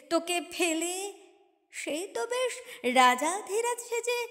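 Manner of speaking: usual speaking voice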